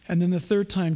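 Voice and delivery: ordinary talk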